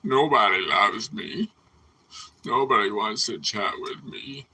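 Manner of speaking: slow, whiny pity-me voice; hammed-up sulk to an empty chat; mock self-pity. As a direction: play the lonely poor-me card.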